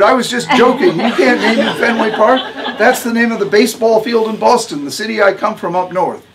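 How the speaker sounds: high, loud, hurried backpedalling; a young doctor scrambling to take it back; flustered protest. A narrator's reading. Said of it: "I sputtered".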